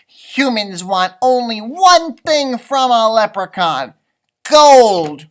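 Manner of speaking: high, loud, scoffing; scornful leprechaun. Acted. Leprechaun scoffing to the puppy about humans. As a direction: sneer at the very idea. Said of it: "I snorted".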